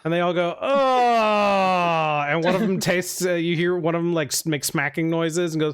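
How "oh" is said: long, higher drawn-out "ohh"; extended exclamation, gliding down; crowd's "oh". Acted. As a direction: voice a group reacting together. Onlookers all react to the beans.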